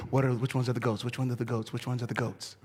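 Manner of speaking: worried mumbling to yourself; anxious muttering. Retold or imitated. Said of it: "we immediately start going to".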